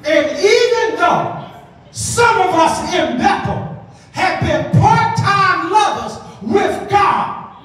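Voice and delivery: rising shouted preaching; preaching at full force. Acted. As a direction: preach it up, loud and high.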